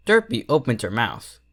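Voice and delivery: ordinary delivery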